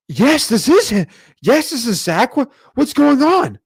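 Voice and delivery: eager answer. Retold or imitated. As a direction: jump on the call like big news. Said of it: "you might be like".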